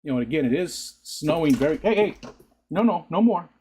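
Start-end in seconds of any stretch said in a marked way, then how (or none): 1.8-3.5 sharp scolding to the dog. Real, not acted. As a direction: tell the dog off firmly.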